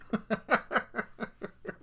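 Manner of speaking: high, soft rolling chuckle; real laugh. Real, not acted. Storyteller laughing after boasting he'd win, real chuckle. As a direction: chuckle at your own boast.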